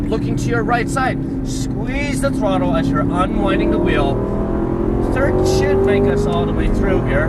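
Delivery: loud, urgent coaching calls; shouting over engine noise. Real, not acted. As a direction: call instructions over a roaring engine.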